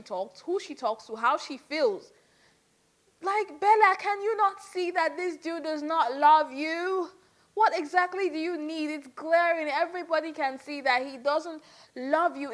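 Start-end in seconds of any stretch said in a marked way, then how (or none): 3.2-12.5 higher, louder, exasperated plea; exasperated appeal. Real, not acted. Vlogger urging Bella to see he doesn't love her, exasperated. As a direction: plead with her to open her eyes.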